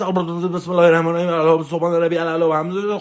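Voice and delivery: rattled-off, perfunctory prayer recital; mock careless gabbled praying; superficial prayer. Shown as a demonstration. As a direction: race through the prayer just to get it over with.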